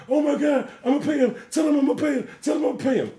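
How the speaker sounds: mock sobbing scream in the background; imitating screaming crying. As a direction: shriek it like a terrified man begging. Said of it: "screaming and crying".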